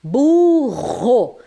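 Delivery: "Burro" com U esticado e RR aspirado; RR forte. Shown as a demonstration. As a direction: ponha força no RR de "Burro". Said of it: "a pronúncia exige força". (erre potente em "Burro")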